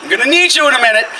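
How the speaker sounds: high, hurried acknowledgement; quick high mock call; calling back. Calls back to the bystander.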